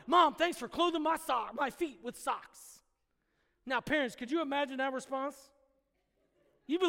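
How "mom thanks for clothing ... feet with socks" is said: loud gushing thanks fading at the end; eager thanks. Pretend. Child thanking mom for socks, gushing. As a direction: gush over the socks.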